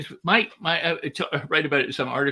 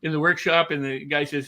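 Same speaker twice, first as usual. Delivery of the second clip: normal talking voice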